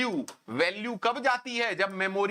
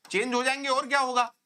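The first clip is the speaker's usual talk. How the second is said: quick, higher, scoffing retort; mocking exasperation; 6 semitones higher, faster than usual. Real, not acted. Teacher scoffing at a student's obvious question, high and quick. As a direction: toss it off as if the answer is painfully obvious.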